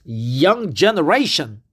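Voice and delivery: normal talking voice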